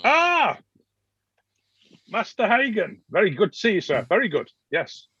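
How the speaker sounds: high, delighted noble welcome; effusive nobleman greeting. Acted. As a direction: gush over the guest like a fussy lord. GM voices the noble arriving.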